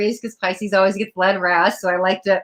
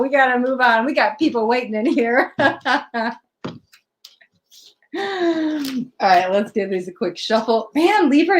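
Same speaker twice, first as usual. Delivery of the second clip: usual speaking voice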